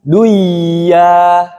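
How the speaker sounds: "duya" with the n swallowed into a long, held y; wrong merged reading. Shown as a demonstration. Teacher showing what is not to be read, merged and held. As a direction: stretch "duya", merging nun into a drawn-out ya. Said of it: "Tidak dibaca".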